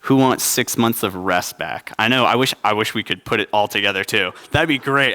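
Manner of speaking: ordinary talk, nothing marked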